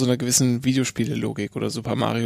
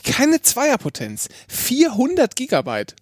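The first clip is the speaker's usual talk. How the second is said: puzzled disbelief; 9 semitones higher, slower than usual. Real, not acted. Odd SD card size, puzzled.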